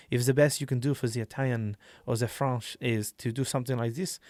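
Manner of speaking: loosely faked Italian or French accent; rough Italian-French accent. Shown as a demonstration. Telling players any rough attempt will do, mock Italian-French accent. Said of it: "I can't do an Italian accent".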